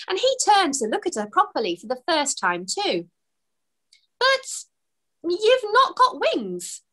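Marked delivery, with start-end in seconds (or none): 4.2-6.8 much higher, slow puzzled question. A narrator's reading. Elf puzzled by the wingless fairy. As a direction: wonder aloud, slowly. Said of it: "he said, puzzled".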